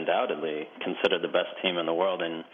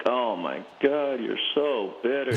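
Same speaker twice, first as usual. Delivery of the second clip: mocking critics; 5 semitones higher, slower than usual. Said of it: "everybody's like".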